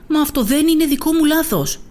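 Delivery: put-on child yells back in denial; shouting at mom. Boy shouts at his mother that it isn't his mistake. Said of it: "της φώναξα".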